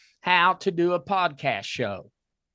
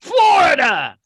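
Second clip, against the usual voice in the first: excited shout; 10 semitones higher, 9 dB louder, slower than usual. Real, not acted. Reveals top listening state.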